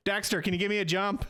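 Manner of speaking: lightly acted plea for help; calling out. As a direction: call over to someone, in character. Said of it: "pokes his head out".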